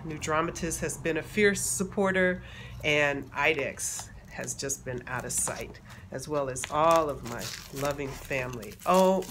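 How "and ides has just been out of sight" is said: usual speaking voice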